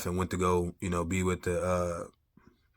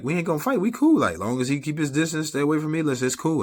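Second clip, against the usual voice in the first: insisting calmly; 8 semitones higher, 4 dB louder than usual. Retold or imitated. Assuring ex he won't fight.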